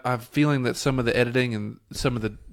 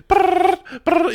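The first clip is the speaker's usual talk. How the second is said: very high mock brrr of gunfire; spray a mouth-made machine gun; imitating machine gun; 4 dB louder than usual. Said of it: "And she's just like".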